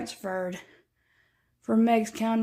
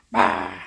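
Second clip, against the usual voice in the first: playful bah; 4 dB louder than usual. Real, not acted. Playful noise at the cat.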